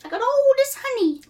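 high-pitched English schoolboy; little British boy accent. Acted. Christopher Robin greets Piglet in a little British boy accent. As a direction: announce yourself as a small British boy. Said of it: "your little British boy accent".

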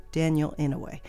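ordinary delivery

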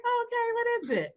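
high, quick, falsely agreeable; put-on tone. Repeating her reply to the attendee, falsely agreeable. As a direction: rush it out in a high polite voice. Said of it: "If you said it with that tone".

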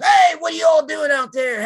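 loud, high-pitched on-air hype voice; Puppet. Retold or imitated. Boss going live on radio, loud showman holler. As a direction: holler out a big showman greeting. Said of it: "it was just like night and day".

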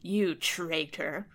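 very slow, heavy; slow accusation. Acted. Fairy accusing the king, slow and heavy. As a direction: lean on each word.

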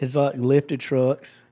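laid-back Georgia country talk, unhurried; Georgia accent. Shown as a demonstration. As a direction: drawl it out like you're back home in Georgia. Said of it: "this is my Georgia accent".

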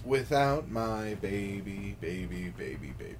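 fading lyric recital; reading lyrics. Acted. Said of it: "read to you".

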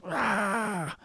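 high, softened mock wail; imitated child's wail. Child whose knee got banged, imitated high wail. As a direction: mimic a hurt kid crying out.

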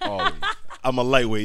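high squeal of laughter, then loud; laughing squeal. Real, not acted. Laughing about getting high.

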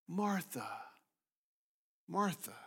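soft, tender calling of her name. Acted. Jesus gently calling Martha, soft and tender. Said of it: "emphasizes the tenderness toward her".

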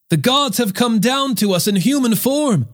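high, mildly exclaiming call; narrated crowd shout. A narrator's reading. Astonished crowd after the healing, mild narrated shout. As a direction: proclaim it high, without real volume. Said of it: "they shouted".

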